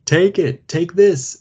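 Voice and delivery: high, loud urging call; man on the bank shouting to Frank. A narrator's reading. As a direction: push him to grab the stick. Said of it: "shouted the man".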